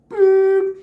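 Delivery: high electronic boop; mock beep of the face scanner, high and loud; machine beep.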